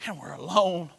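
ordinary delivery

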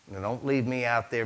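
ordinary talk

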